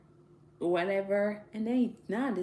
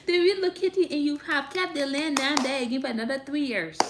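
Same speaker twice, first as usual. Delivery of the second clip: laughing; 5 semitones higher, 6 dB louder than usual. Real, not acted. Frustrated about people's mistake.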